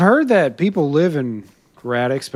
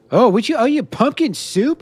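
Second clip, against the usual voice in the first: surprised; 10 semitones higher, 5 dB louder than usual. Real, not acted. Offered food in game.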